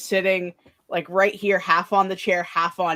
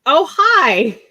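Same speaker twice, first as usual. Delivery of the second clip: high loud startled Oh, hi; surprised hi; 6 semitones higher, 9 dB louder than usual. Retold or imitated. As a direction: act startled to find someone.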